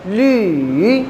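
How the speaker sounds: ली dipped low then rising; third tone. Shown as a demonstration. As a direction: sing ली down and back up. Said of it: "पहले नीचे की तरफ पढ़ूँ".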